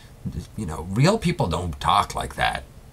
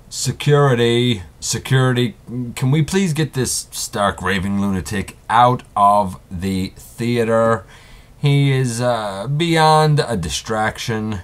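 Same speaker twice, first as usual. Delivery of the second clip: louder, higher call for security; 4 semitones higher, 6 dB louder than usual. Acted. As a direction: shout for the guards, exasperated. Host calls security on intruder.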